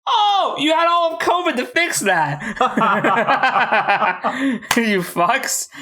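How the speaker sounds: high-pitched indignant outburst breaking into laughter; mock outrage. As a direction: accuse them in hammed-up, laughing indignation. Mock accusation over bad CGI.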